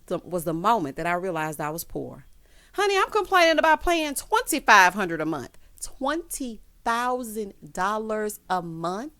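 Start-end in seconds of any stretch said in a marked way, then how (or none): 2.8-5.6 higher, louder indignant outburst; loud shocked complaint. Real, not acted. Shocked at the rent. 5.8-9.1 slow, drawn-out stunned question; slow incredulous repeat; stunned disbelief. Real, not acted.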